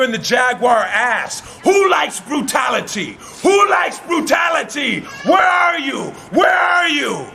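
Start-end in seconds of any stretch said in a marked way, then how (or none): 1.6-7.3 high, ringing repeated challenge; escalating arena taunt; shouted demand. Acted. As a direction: call out the cowering crowd. Beasts demand answers from crowd.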